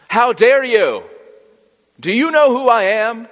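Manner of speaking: high, loud, hammed-up outrage; mock indignation. Preacher imagining Jesus offended by the Samaritan woman, mock indignant. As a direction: play an offended bigwig pulling rank, loudly.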